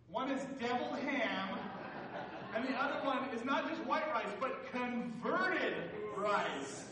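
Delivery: loud, high, drawn-out stress on "deviled" and "converted"; emphatic punchline. Real, not acted. Preacher landing a food pun for the congregation, loud and emphatic. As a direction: hammer "converted", stretching it out loudly.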